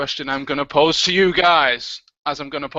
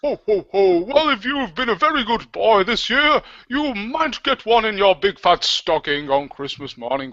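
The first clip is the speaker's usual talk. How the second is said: booming Father Christmas; Santa voice; 3 semitones higher than usual. Acted. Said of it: "Please, Santa".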